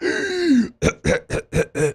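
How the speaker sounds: high imitated gasp for breath; gasp for air. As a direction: mimic a desperate gasp. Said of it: "without a normal breath. Like".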